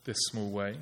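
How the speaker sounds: timid tiptoeing request. Retold or imitated. Praying timidly to God, soft and apologetic. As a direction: ask as if walking on eggshells. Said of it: "treading softly".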